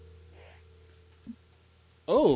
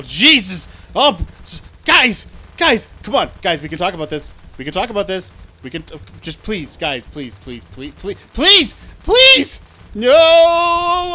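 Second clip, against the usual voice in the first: high, loud desperate pleading; terrified gibbering; 6 semitones higher, 12 dB louder than usual. Real, not acted. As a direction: beg for your life, screaming. Begging enemies while fleeing.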